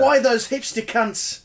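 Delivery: high, loud, slow put-on Australian accent; mock Australian accent. Bandmate mimics an Australian heckling dice players, hammed-up Australian accent. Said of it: "insert an Australian accent over that comment".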